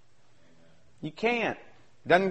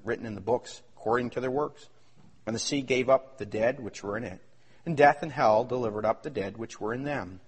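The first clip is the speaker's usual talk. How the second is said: lower, softer solemn reading; scripture reading; 10 semitones lower, 6 dB quieter than usual. Acted. Preacher reading Revelation to the congregation, low and solemn. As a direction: read the judgment with solemn weight.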